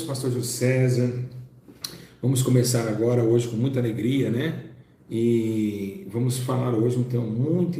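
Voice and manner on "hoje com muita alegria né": ordinary delivery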